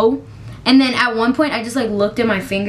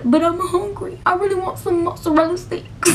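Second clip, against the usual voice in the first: whiny plea; 8 semitones higher than usual. Retold or imitated. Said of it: "And I said".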